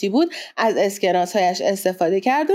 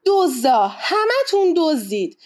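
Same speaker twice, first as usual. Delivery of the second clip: raised, drawn-out angry accusation; outraged cry; 6 semitones higher, slower than usual. A narrator's reading. Captain accusing the whole crew of theft, outraged cry. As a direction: narrator voicing an indignant captain's outburst. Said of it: "ناخدا اسپیدی داد زد".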